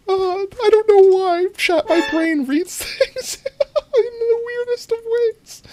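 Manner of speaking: high, squeaky laughing voice; cracking up at yourself. Real, not acted. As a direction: talk helplessly through your own laughter. Cracks up at own misreading.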